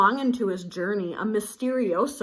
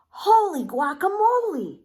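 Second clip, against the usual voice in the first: squeaky high burst of amazement, much louder; surprised exclamation; 10 semitones higher, 6 dB louder than usual. A narrator's reading. Kitten startled by his reflection, high and loud. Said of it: "exclaimed Skippy John Jones".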